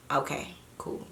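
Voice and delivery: whispered okay, barely voiced. Answering the police officers meekly, in a whisper. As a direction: breathe it out like you don't want trouble.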